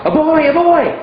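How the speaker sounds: high, eager shouted answer; mock student shout. Student shouting the answer instantly, high and eager. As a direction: blurt it out at once, high and loud. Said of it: "And you shout".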